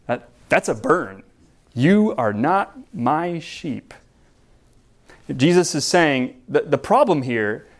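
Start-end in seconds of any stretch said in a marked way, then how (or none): 1.7-3.9 drawn-out, every word weighed; slow emphatic repeat. Acted. Pastor repeating Jesus' rebuke to the leaders, slow and emphatic. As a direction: land each word of the rebuke slowly.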